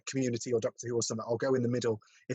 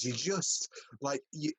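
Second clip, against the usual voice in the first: normal talking voice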